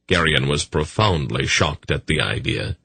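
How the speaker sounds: ordinary delivery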